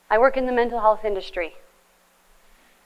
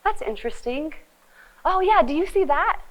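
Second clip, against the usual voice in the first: enthusiastic reply; 6 semitones higher than usual. Shown as a demonstration. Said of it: "enthusiastically come and be like".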